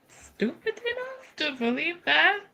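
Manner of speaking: ordinary talk, nothing marked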